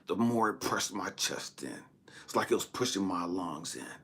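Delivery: normal talking voice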